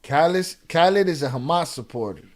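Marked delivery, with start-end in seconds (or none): none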